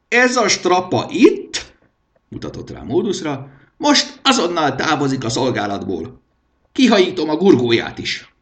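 high, loud royal dismissal; a high-pitched king in a temper; angry king. A narrator's reading. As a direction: throw him out with a shrill command.

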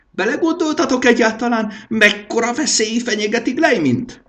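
high, loud mocking scorn; a high-pitched king ranting at fools; nervous scornful king. A narrator's reading. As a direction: jeer at them, nervy and rising. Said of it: "idegesen felnevetett".

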